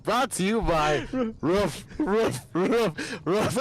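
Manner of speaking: slow gruff announcer read. Retold or imitated. Quoting the dog food ad, slow gruff announcer read. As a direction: announce the sponsor slowly in a gruff put-on voice. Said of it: "the one with the gruff".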